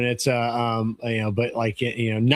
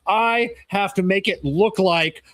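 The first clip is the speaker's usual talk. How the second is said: higher, louder and slow, breaking into a laugh; mock yelling; 6 semitones higher, 5 dB louder, slower than usual. Manager pretending to yell at the mechanic, laughing mock shout. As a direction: put on a show of yelling while laughing.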